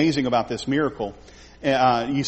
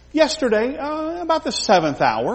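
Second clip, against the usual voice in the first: higher, eager answer; servants' eager reply; 11 semitones higher than usual. Retold or imitated. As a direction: answer brightly and up high.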